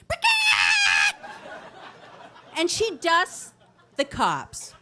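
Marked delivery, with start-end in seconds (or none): 0.1-1.3 piercing, very high chicken squawk; loud imitation hen screech. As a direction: shriek it like a panicked chicken taking off. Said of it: "in the voice of a chicken".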